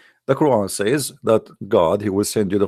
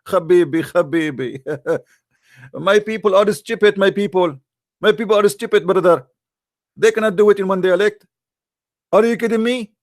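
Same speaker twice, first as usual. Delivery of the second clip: higher, laughing mock voice of Muhammad pleading; laughing mockery; 6 semitones higher than usual. Speaker mocks Muhammad excusing his people, laughing. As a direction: ham up a laughing plea that his people are stupid.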